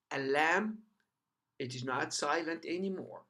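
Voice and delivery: ordinary delivery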